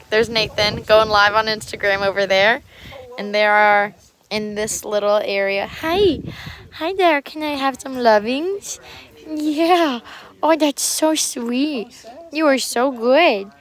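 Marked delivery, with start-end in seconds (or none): 5.7-13.5 loud, high gushing pet talk; cooing to a cat. Real, not acted. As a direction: gush over an adorable cat. Gushing over a sanctuary cat.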